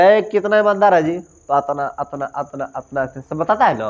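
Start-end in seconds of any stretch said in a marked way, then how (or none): 0.0-1.2 mocking question. Mocking someone who is not honest.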